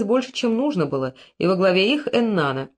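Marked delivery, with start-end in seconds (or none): none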